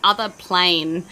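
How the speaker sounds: ordinary delivery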